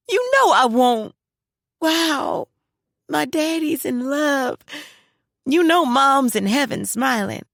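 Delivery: lightly high, touched and gentle; a hint of a moved daughter; tender daughter.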